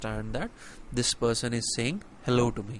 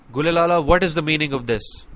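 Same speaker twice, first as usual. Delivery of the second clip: louder, higher, demanding an explanation; angry demand; 4 semitones higher, 5 dB louder than usual. Shown as a demonstration. Teacher demos an angry question to a student. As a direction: snap it out like you're angry. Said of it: "a certain person is angry at someone".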